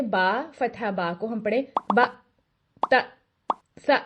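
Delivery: "बा" cut short with a jerk; clipped jerky fatha. Shown as a demonstration. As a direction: chop the vowel off abruptly. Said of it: "इतना छोटा कर देते हैं कि उसमें झटका". (गलत, झटके से छोटा फतहा)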